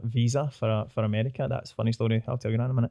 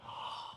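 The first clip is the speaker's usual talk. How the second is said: breathless unvoiced oh; whispered gasp; 21 dB quieter than usual. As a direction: gasp in awe. Gasping at the sight.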